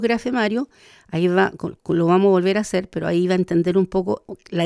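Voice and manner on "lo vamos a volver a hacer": ordinary delivery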